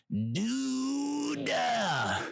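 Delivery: long, high drawn-out wail of frustration; high exasperated cry; exasperated outburst. Real, not acted. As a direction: stretch it out high in sheer exasperation. Exasperated plea to the airline.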